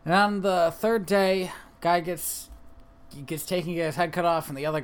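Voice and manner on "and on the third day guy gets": normal talking voice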